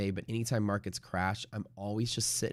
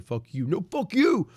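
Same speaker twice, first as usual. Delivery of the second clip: mock furious yell, last words much higher and louder; imitated trader screaming; 8 semitones higher, 6 dB louder than usual. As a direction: act out a Wall Street broker screaming at a client. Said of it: "yelling and screaming at their customers".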